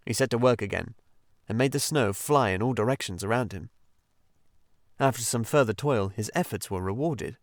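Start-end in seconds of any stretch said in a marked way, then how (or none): none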